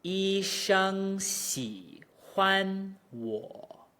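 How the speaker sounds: exaggerated Mandarin tones on "医生喜欢我"; drawn out, each syllable's pitch shaped; tone demo. Shown as a demonstration. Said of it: "The third tone starts halfway down".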